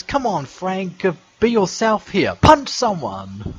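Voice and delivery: a hint of Rickles roasting; Don Rickles. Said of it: "Don Rickles says".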